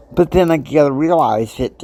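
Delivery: usual speaking voice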